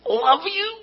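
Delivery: very high-pitched, strained love you; forced affection. Acted. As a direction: squeak out affection through held-in anger. Acted forced words in an argument.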